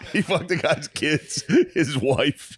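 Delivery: high, slow, laughing repeat; laughing disbelief. Real, not acted.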